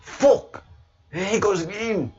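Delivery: very high mock yell; imitating the Bruce yelling. Co-host fed up with the Scottish accent, high mock yell. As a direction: shriek it like an annoyed friend. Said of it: "it makes the Bruce just fling his phone".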